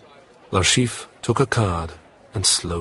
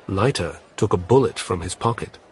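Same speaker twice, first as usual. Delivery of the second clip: ordinary delivery, nothing marked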